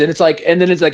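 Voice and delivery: ordinary delivery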